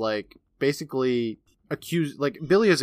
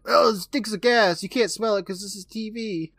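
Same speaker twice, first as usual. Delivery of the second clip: high, hammed-up TV character call; mock calling out; 9 semitones higher than usual. Joking about a TV scene, hammed-up calling out. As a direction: parody a stagy line in a raised pitch. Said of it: "he calls out that it stinks".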